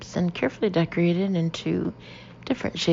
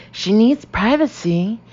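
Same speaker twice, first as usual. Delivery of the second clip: higher, louder and slower, each word pressed; insistent; 5 semitones higher, 7 dB louder, slower than usual. Acted. Jody insisting to the shop woman, louder and insistent. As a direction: insist over her, no arguing. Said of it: "insists the girl".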